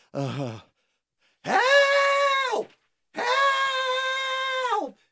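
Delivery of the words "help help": much higher desperate call; high, stretched-out shout of "Help!"; cry for help. Acted. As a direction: yell for help across the water. George cries for help.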